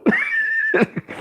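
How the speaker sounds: very high squeal of a laugh; high delighted squeal; gleeful high laugh; high giggle. Real, not acted. Laughing at Pacquiao's audacity.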